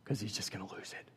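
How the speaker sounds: whispering. Real, not acted. Hushed aside about toddler.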